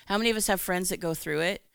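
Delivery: usual speaking voice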